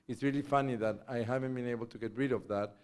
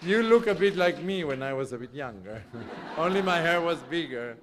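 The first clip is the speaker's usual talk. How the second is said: laughing through a joke, a bit louder; 5 semitones higher, 8 dB louder than usual. Real, not acted. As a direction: chuckle while teasing her. Laughs while joking with guest.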